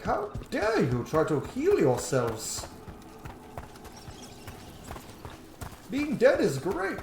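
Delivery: slow, drawn-out indignation with swooping pitch; mock offended. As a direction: play the affronted necromancy crowd, hammed up. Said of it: "They might get offended".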